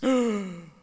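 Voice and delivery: sharp dramatic gasp; loud gasp. Acted. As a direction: gasp in shock. Reaction gasp.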